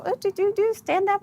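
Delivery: high-pitched voice of an encouraging crowd; imitating eager fans. Retelling what everybody urged her.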